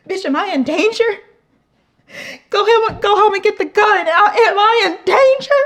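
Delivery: high, loud alarmed yelling; hammed-up panic, shrieking about getting the gun; panicked shout. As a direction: yell it like you're suddenly in danger. Panicked inner reaction, waiter laughing.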